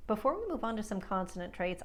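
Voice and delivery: ordinary delivery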